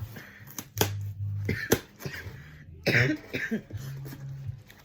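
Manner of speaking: harsh chesty cough; coughing fit. Real, not acted. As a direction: cough hard and long. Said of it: "I do have, like, a nasty cough".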